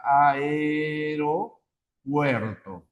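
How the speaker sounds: Aeropuerto drawn out very slowly, syllable by syllable. Shown as a demonstration. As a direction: stretch out Aeropuerto for the class. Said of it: "a ver, aeropuerto". (pronunciación muy lenta, sílaba por sílaba)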